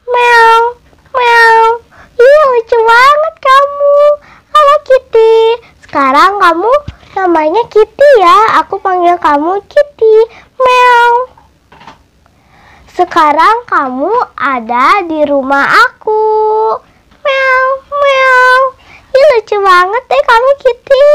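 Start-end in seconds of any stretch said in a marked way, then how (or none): 0.1-1.6 high little meow, meow; cat meows. Pretend. Girl mewing to her new kitten, playful meows. 2.2-5.4 put-on high, delighted baby talk; cooing at kitten. Girl greeting her new kitten, high delighted cooing. 10.6-11.1 high little meow; cat meow. Pretend. Girl imitates her cat. 17.2-18.6 high double meow; cat meows. Pretend. Girl imitates her cat.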